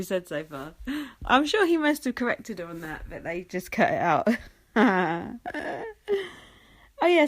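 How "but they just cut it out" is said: ordinary talk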